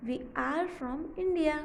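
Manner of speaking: very slow, word by word; slow revision reading; slow rereading. Shown as a demonstration. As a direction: reread slowly with the class. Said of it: "ये result मिलेगा".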